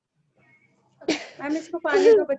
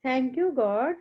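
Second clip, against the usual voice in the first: put-on slow, hushed prayer led for the children; class prayer; 4 semitones lower, 3 dB quieter, much slower than usual. Teacher leading pupils in morning prayer, slow and hushed. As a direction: pray slowly, pausing between every phrase.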